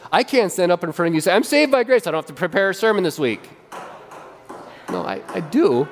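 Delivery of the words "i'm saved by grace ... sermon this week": high start, then a fast rattled-off excuse; ridiculous excuse. Pretend. Pastor pretending to skip his sermon, mock lazy. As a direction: play a lazy pastor, hammed up. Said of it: "let me be ridiculous".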